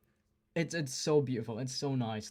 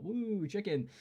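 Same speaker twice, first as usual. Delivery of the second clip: excited cheer; 7 semitones higher, slower than usual. Retold or imitated. Acted cheer on reaching Popeyes.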